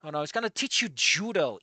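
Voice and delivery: ordinary delivery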